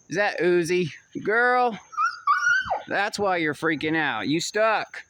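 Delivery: high, loud calling out to the dogs. Real, not acted. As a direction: call the dog over across the yard, high and loud. Calling his dogs in the yard.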